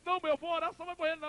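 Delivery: high wailing protest to a mother; acted desperate plea. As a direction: refuse it in a high, pleading cry. Son begs dying mother to stay.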